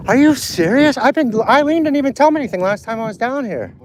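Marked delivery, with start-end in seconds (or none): 0.1-2.6 high, rising real disbelief; stunned disbelief. Real, not acted. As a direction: ask it still reeling from the news. Hearing shocking news of friend.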